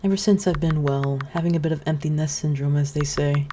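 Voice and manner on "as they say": ordinary delivery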